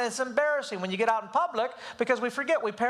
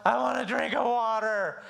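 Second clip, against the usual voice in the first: whiny; 2 semitones higher, slower than usual. Retold or imitated. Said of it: "I hate the whiny".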